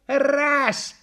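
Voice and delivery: put-on high, piping boy; boy's voice. A narrator's reading. Boy answers the clown from the crowd, high-pitched. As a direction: call out like a little boy. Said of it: "сказал мальчишка".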